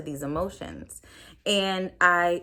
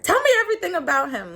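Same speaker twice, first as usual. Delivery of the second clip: much higher and fast, eager; eager plea; 11 semitones higher, 5 dB louder, faster than usual. Retold or imitated. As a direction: beg your mother for every detail. Eagerly asking her mother.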